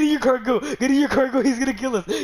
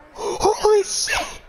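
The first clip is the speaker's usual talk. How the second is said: slow, breathy, much higher disbelief; awed hushed gasp; 12 semitones higher, much slower than usual. Real, not acted. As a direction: breathe it out in awe. Awed at the car win.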